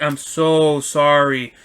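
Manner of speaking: slow, stretched-out retold apology; drawn-out apology. Driver to customer after the spill, deeply apologetic. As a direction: drag out the apology.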